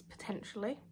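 unvoiced whisper aside. Real, not acted. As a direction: drop to a whisper.

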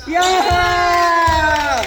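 drawn-out cheer. Real, not acted. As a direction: hold the cheer out loud. Cheering a shot.

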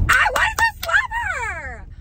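high, slow, far-carrying shout; outraged shout. Real, not acted. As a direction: yell it out in real outrage. Outraged retelling rude associate.

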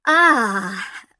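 loud, dismayed merchant's cry; anguished cry. A narrator's reading. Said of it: "cried the merchant".

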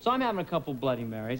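ordinary talk, nothing marked

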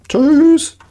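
squeaky goodbye. Real, not acted. Bailing out of a fight.

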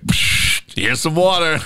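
scoffing, high mock sneer; sarcastic bartender. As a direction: scoff it out, mocking. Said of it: "And he was like".

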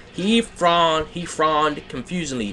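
ordinary delivery

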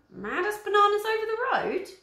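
high squeaky guess; chirpy girlish voice; high silly voice. Acted. Flavia guessing excitedly.

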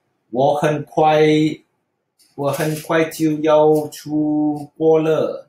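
slow Mandarin with an English accent; halting English-accented Mandarin; English speaker's Mandarin. Shown as a demonstration. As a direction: read it slowly like an English learner. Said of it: "normally you say".

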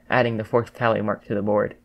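plain reading voice

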